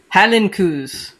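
slow, stretched-out Scottish try at "Highland coos"; attempted Scottish accent. Shown as a demonstration. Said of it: "I can't do a Scottish accent".

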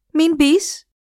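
ψηλότερη γυναικεία προειδοποίηση; σταμάτα τον με μια κραυγή; φωνάζει να μη μπει. Acted. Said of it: "εκείνη του φώναξε". (η σύζυγος φωνάζει στον άντρα να μη μπει, ψηλή φωνή)

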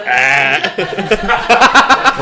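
loud, long silly "aah"; mock charging cry. Shown as a demonstration. Player mimics a flailing charge, loud comic yell. Shows charging with swinging arms.

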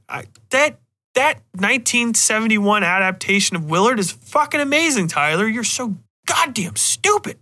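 lampoon a shrill viewer scolding him; high mocking whine; mock angry critic.

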